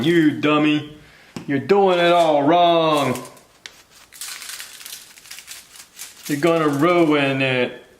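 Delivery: slow, drawn-out mocking heckler; Andrew Dice Clay. Expert viewer heckling the cook, slow mocking impression. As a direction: stretch it out, scolding like a know-it-all, pitch lifted. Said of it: "sound like Andrew Dice Clay".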